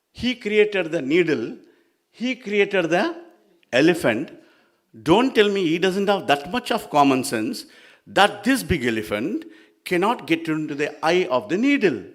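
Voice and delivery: high, loud, emphatic arguing; indignant disbelief. Acted. Sage disputing God's struggle with needle, loud high indignation. Sage scoffs at Narada's tale.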